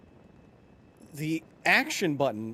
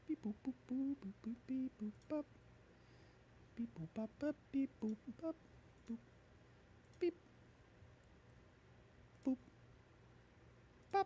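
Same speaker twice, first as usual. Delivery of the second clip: robot beep noises; 9 semitones higher, 20 dB quieter, faster than usual. Pretend. Imitating robot beeps while waiting.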